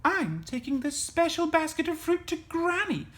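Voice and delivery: put-on high little-girl storybook voice; Little Red character. A narrator's reading. Little Red telling the wolf her errand, high girlish voice.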